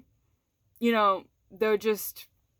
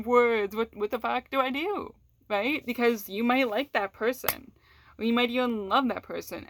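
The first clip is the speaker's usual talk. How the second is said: usual speaking voice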